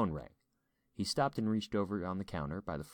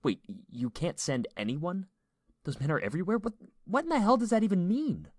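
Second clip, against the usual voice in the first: high, rising incredulous questions; confused disbelief; 12 semitones higher, 6 dB louder than usual. A narrator's reading. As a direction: echo it in disbelief, building to a loud outburst. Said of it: "Eli was confused".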